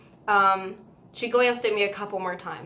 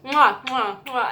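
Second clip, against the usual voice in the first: blown kisses; 4 semitones higher, 5 dB louder, slower than usual. Retold or imitated. Singer blowing kisses again.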